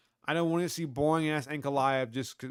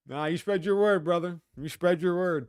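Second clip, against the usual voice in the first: usual speaking voice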